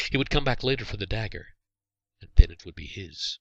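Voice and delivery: plain reading voice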